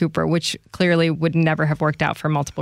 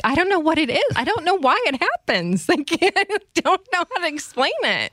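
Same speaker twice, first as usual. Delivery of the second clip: pitched way up, helpless and laughing; a giggly, bewildered squeak of a reply; high-voiced, flustered and half-laughing; 11 semitones higher than usual. Retelling her flustered reply.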